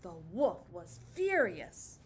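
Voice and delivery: low, loud, slow; furious narration. A narrator's reading. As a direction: growl out the wolf's fury. Narrating wolf's rage.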